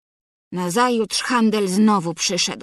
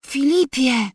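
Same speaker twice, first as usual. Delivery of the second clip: a sick mother calling once more; weak voice, called again; 3 semitones higher than usual. A narrator's reading. Sick mother calling her husband a second time, weakly. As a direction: try his name a second time, a little fuller but still weak. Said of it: "rzekła słabym głosem".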